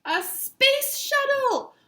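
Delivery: very high, slow, loud cry; excited scream. Acted. Marty spotting the shuttle, very high and loud. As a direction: draw the discovery out high. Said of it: "Marty screamed".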